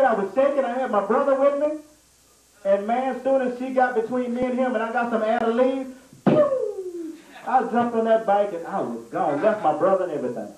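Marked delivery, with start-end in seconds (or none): 6.3-7.1 high whistle-like pew; high quick pew; zoom sound effect. Retold or imitated. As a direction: make the sound of zooming off. Sound of speeding off.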